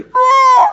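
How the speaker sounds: vocal car engine revving up; storyteller mimicking the car roaring, loud.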